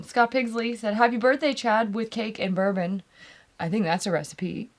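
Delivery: ordinary talk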